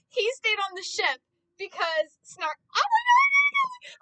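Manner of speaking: very high, loud fangirl squeal; overexcited squealing. Retold or imitated. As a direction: build from excited shout into a high squeal. Reliving excited reaction to show.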